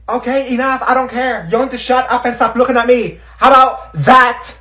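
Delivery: high, loud snappy; angry outburst. Acted. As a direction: lash out at them. Irritated girl lashing out.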